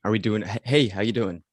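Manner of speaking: ordinary talk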